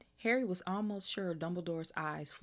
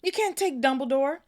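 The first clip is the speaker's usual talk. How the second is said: yelled much higher and much louder; full yell; 11 semitones higher, 11 dB louder than usual. A narrator's reading. Hagrid yelling to stop Dumbledore being taken, full shout. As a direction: let it rip as a furious giant. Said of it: "yelled Hagrid".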